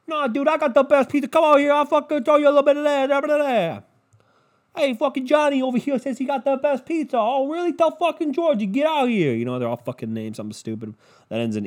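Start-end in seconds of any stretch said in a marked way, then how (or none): 0.0-3.8 higher, louder New York tough-guy brag; New York pizza guy. Retold or imitated. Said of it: "we're not New York". 4.8-7.2 New York pizza guy. Retold or imitated. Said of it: "we're not New York". 7.2-9.3 New York pizza guy. Retold or imitated. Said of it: "we're not New York".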